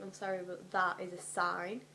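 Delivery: ordinary talk, nothing marked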